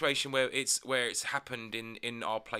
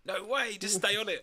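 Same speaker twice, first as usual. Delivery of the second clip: mock; 10 semitones higher than usual. Joking reply about the bus.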